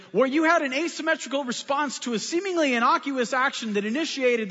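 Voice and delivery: high-pitched, wordy scolding; mock indignant lecture. Pastor imagining scolding the florist, mock-indignantly. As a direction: lecture him in a pretend high-flown tirade.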